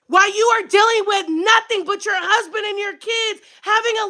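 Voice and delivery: high-pitched, indignant rant; upset yelling. Real, not acted. Single mom contrasting their Christmas with her own, upset yelling. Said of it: "Sorry for yelling".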